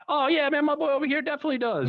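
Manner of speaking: a neighbor vouching for his friend; friendly neighbor voice. Retold or imitated. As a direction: chirp it out like a helpful neighbor. Neighborhood guy answers, acted quote.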